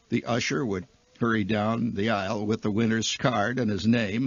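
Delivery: ordinary delivery, nothing marked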